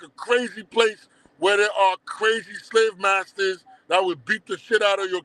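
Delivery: ordinary talk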